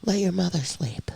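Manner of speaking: whispered, so as not to wake her; hushed whisper. Retold or imitated. Husband whispering to son to let mom sleep. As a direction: whisper it as a father to his son. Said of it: "He's like".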